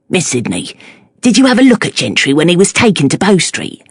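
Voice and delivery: put-on Cockney accent. A narrator's reading. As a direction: praise him like a proud Cockney. Cockney fishmonger asks.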